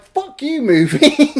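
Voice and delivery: high then loud fed-up curse; mock angry. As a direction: curse the movie in mock outrage. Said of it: "and I'm like".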